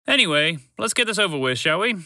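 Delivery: high, hurried-along impatience; impatient. A narrator's reading. Trevor wanting the task over with, impatient. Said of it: "Trevor said impatiently".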